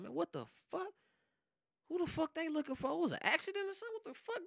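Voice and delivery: questions spilling out in rising confusion; retold disbelief. Retells seeing cars and police.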